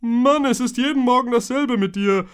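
put-on high, loud annoyed boy's voice; a frowning boy complaining; grumpy Toby. A narrator's reading. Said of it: "Toby runzelte die Stirn". (genervte, hohe Jungenstimme)

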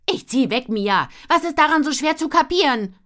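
loud, high, exasperated yell; frustrated shout. Acted. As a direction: yell it, fed up.

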